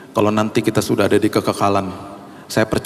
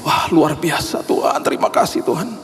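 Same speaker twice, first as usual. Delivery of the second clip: retold thankful joy; 7 semitones higher than usual. Preacher recalls thanking God for a remission, marvelling. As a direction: savour the news like a small miracle.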